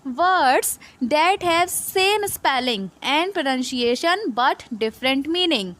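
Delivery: very slow, word-by-word definition read; teacher reading a definition to students; slow reading aloud. Shown as a demonstration. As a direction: read the definition slowly off the slide. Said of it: "इसका description में क्या लिखा हुआ है".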